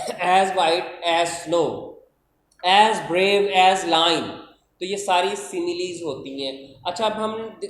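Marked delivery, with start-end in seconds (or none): 0.1-4.3 very slow, spaced, rising louder; slow, emphatic classroom examples; slow example phrases. Shown as a demonstration. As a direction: lay the similes out slowly. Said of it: "इसी तरह".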